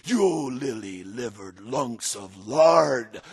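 slow, scornful pirate insult; lashing out. Acted. Dirty Joe scolding his frightened crew, mock scorn. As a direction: spit it at the cowards, drawn out. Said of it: "lashed out Dirty Joe".

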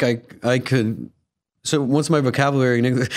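usual speaking voice

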